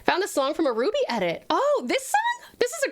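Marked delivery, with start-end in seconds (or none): 1.5-2.9 delighted surprise. Real, not acted. Reacts to a song.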